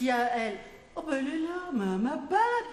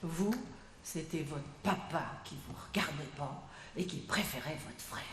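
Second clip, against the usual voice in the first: confidential hush; 7 semitones lower, 8 dB quieter than usual. Acted. Gossiping bartender lowers voice.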